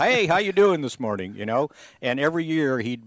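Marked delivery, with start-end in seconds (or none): 0.0-1.3 higher, hearty mock hello; retold friendly greeting. Host acting out his morning hello to the pool cleaner, hearty. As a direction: call out a cheery imitated greeting.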